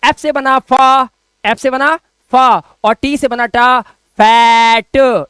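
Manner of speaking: slow phonics build-up to a loud, stretched फैट; sounding out letters. Shown as a demonstration. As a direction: hold the open ae of फैट, then "tuh". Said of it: "बीच में बांस फाड़ा".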